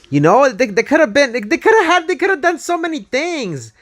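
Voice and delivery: excited. Real, not acted. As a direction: rant like it's a huge missed opportunity. Excited rant about missed opportunity.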